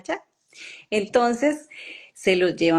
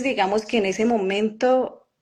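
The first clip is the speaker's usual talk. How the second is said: ordinary delivery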